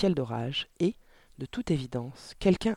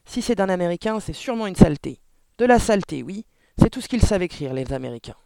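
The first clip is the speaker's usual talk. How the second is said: loud, raised, scornful rant; indignant exclamation; 4 semitones higher, 8 dB louder than usual. A narrator's reading. Father ranting about American writers, indignant. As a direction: rail with disgust, higher and louder. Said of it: "s'écria monsieur Verdebois".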